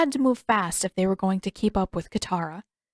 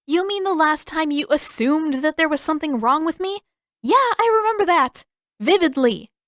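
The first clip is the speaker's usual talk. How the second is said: put-on much higher, louder, biting girl voice; sarcastic girl; 8 semitones higher, 4 dB louder than usual. A narrator's reading. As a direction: fire it back sarcastically. Sister fires back sarcastically.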